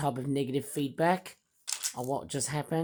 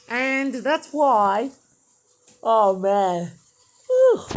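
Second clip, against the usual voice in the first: loud whew; 7 semitones higher, 9 dB louder, much slower than usual. Real, not acted. Exhausted sigh after speaking.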